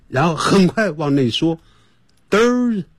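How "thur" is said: Thur- with tongue between teeth, pulled back fast. Shown as a demonstration. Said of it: "马上往内缩". (Thur-先吐舌头再快速缩回)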